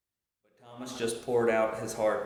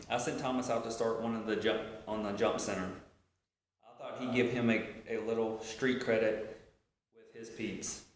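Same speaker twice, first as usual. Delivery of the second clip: normal talking voice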